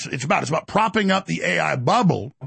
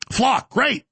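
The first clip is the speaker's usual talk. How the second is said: sarcastic; 8 semitones higher than usual. Real, not acted. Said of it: "sarcastic jab".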